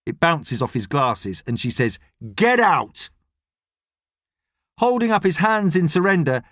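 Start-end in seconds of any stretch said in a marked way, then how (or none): 2.3-3.1 higher-pitched, sharp order to leave; angry order. A narrator's reading. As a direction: throw him out with two words.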